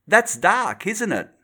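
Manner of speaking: high, pressing question; a landlady pinning him down; a touch of the landlady's plain accent; blunt landlady. Acted. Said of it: "changed her accent".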